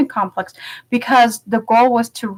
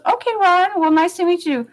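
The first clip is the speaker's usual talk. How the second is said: cheerful politician; 9 semitones higher than usual. Retold or imitated. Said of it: "He goes".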